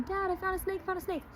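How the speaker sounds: high, eager little-boy voice, lightly acted; proud boy announcing his find; excited kid. Young [name] telling his dad he found a snake, high and eager.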